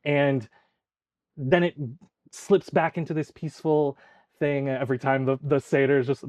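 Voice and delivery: ordinary talk, nothing marked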